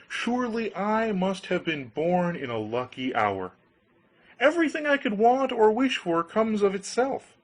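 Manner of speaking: naive Hans pleading. Acted. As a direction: beg like a trusting young fool.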